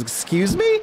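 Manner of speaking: sharp high disbelieving excuse me; incredulous. Acted. Dwarf taken aback by the slaves remark, high incredulous. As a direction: jump way up in pitch, offended shock.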